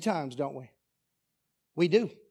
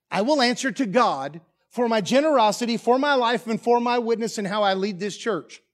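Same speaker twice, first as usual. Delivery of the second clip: louder and higher, slowing on key words; full-force preaching; 4 semitones higher, 6 dB louder than usual. Acted. Pastor declares his certainty of heaven to the congregation, loud and fervent. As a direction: drive it home like a revival preacher.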